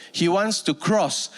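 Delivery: ordinary delivery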